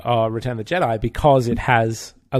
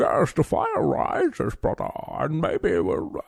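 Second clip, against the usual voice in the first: muffled, theatrical villain voice through a mask; Bane impression; 13 semitones higher, 4 dB quieter than usual. Podcaster mocks Bane's hard-to-understand voice, in a muffled villain impression. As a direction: boom it out like a masked villain. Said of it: "tried to do that impression".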